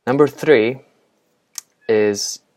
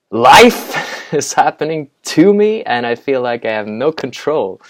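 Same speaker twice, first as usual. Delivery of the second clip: mock overwhelmed outburst; 5 semitones higher, 9 dB louder than usual. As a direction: burst out loud and high on LIFE, hammed-up. Acting out an overwhelmed stressed-out person.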